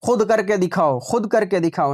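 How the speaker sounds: ordinary delivery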